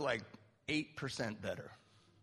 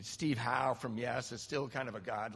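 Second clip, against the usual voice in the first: ordinary talk, nothing marked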